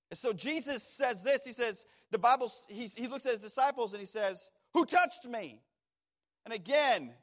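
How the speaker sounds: preacher climbing to a peak; fervent preaching. Acted. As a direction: drive toward the question with force. Preacher builds to Jesus' question.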